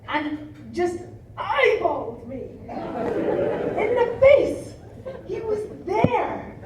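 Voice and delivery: high giggling disbelief, slowed; laughing. Real, not acted. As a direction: laugh through the words. Gorilla staring close, laughing.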